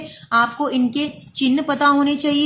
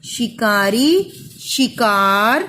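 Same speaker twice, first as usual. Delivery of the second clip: slow spelling-out; 3 semitones lower, much slower than usual. Shown as a demonstration. Teacher to child, sounding the word out slowly. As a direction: stretch each sound slowly while writing. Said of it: "इसको बोल बोल कर लिखिए".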